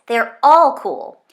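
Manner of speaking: loud, slow, emphatic; excited emphasis. Acted. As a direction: hammer it home with enthusiasm. Eager kid explaining the show.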